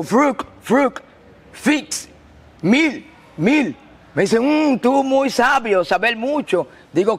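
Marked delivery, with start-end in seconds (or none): 0.0-3.8 broken English. Retold or imitated. Speaks broken English to captain. 4.4-6.7 mock foreign captain, slow and high; broken Spanish. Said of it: "él no entendía bien español".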